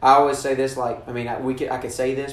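ordinary talk

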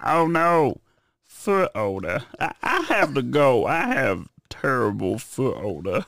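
Cleveland Brown impression, high and slow. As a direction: do Cleveland from Family Guy. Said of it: "Cleveland Brown".

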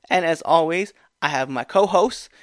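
ordinary talk, nothing marked

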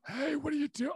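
high-pitched, throat-scraping impression; Badger. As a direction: push the voice up until it scrapes. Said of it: "won't be able to do Badger".

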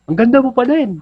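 high, loud yelled compliment; mock yell of a compliment. Husband shouting a compliment at his wife mid-fight, high mock yell. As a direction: shout a sweet line as if in a fight. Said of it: "sigawan ko sa".

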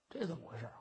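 ordinary delivery, nothing marked

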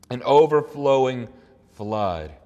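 "overflowing" loud, then "flood" set apart after a pause; stress on flood. Real, not acted. Preacher highlighting a key word of the verse, "flood" set apart. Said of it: "I'm gonna emphasize the word flood".

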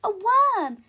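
high, loud delighted cry; thrilled at finding a worm; excited exclaim. Acted. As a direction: Belle shows off a worm, thrilled. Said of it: "she exclaimed".

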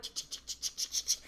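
whispered rapid ch- ch- sounds; frantic unlocking noise. Retold or imitated. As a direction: fumble with the locks in a panic. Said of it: "So I'm like".

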